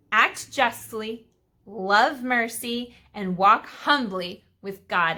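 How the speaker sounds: slow, pointed reread of each virtue; slow emphatic rereading. Shown as a demonstration. Teacher rereading the verse to drive it home. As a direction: hammer home each phrase slowly. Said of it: "Let me read that again".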